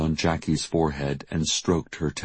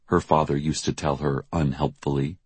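usual speaking voice

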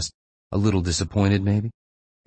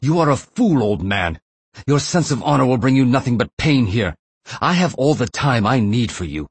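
raised, high-pitched angry tirade; angry shout; 6 semitones higher, 3 dB louder than usual. A narrator's reading. Captor blaming the man in the chair, angry shout. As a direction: berate him loudly, pitch jumping up. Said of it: "he shouted as if angry".

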